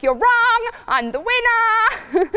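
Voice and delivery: mock stallion refusing to accept losing; stubborn stallion. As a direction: insist like a horse sure he won. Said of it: "He'd be like".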